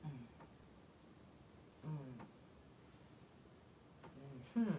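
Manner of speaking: unvoiced sheepish whisper; mock-guilty hushed mumble; whispered admission. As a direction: whisper it, caught out.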